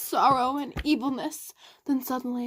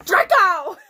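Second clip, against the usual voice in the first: loud shouted name; a shouted Draco; 7 semitones higher, 10 dB louder than usual. A narrator's reading. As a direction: call him out sharply. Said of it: "I shouted".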